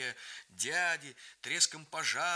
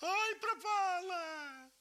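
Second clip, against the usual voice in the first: despairing wail; much slower than usual. A narrator's reading. Grandfather despairing at the fire.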